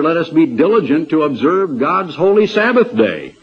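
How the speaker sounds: ordinary talk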